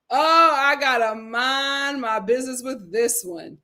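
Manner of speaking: slow, stretched words with a playful lilt; drawn-out quip. Acted. Host repeats her own excuse to stay out of it, drawn out. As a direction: linger on each word for comic effect.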